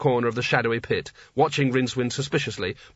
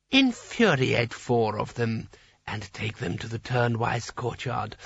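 vizier voice; 3 dB quieter, slower than usual. A narrator's reading. Vizier gives orders.

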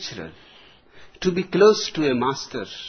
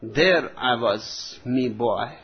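put-on old British colonel voice; 5 semitones lower, 3 dB quieter, slower than usual. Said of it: "a retired British colonial army officer".

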